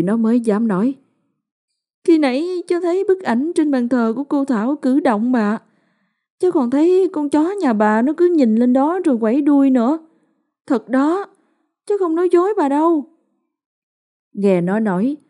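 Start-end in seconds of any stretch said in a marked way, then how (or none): 2.1-13.0 put-on high child's voice, rushing the last words; a boy insisting he tells the truth; child's voice, urgent, much higher; earnest little boy. A narrator's reading.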